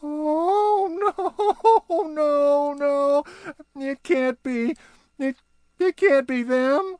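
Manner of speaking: very high, laughing disbelief; Barnaby realizing who it is, very high; dawning dread. Acted. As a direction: realize the awful truth.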